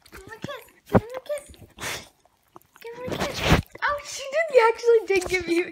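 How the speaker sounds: high cooing coax; high-pitched pet talk. Real, not acted. Coaxing dog, high baby talk.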